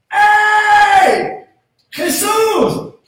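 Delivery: shouted high cry for attention; desperate blind man's shout; calling out. Acted. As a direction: yell to be heard from afar. Blind men crying out to Jesus.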